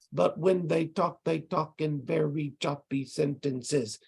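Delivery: "they talk in very choppy sentences" slowed, each syllable separated. Shown as a demonstration. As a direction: demonstrate choppy speech, equal beats on "when they talk, they talk". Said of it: "sounds real choppy".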